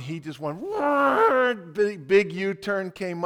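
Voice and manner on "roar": loud imitated animal roar; mock roar. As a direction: roar it out like a beast. Retold street scene, roar.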